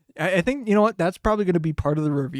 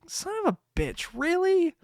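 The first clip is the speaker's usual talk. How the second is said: much higher, fast exasperated squeak; exasperated outburst. Retold or imitated. About the car accident, a high exasperated squeak. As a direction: squeak out mock outrage. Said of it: "I'm just like".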